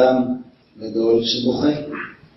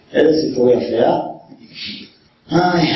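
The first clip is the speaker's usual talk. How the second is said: ordinary delivery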